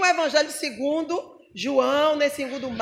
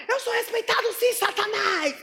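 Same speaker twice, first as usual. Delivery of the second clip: pastor retrucando; 6 semitones higher than usual. Retold or imitated. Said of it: "ele falou".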